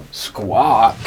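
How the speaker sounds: low croaky squawk like a bird. Acted. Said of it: "The bird says".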